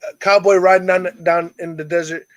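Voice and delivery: normal talking voice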